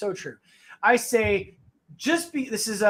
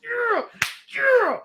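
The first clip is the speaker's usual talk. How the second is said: excited; 14 semitones higher than usual. Retold or imitated. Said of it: "I was like".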